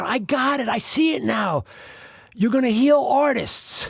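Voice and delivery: excited healer, pitched high; sudden excited realization. Retold or imitated. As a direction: burst out with a retold eureka. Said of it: "all of a sudden, she jumps out of her chair".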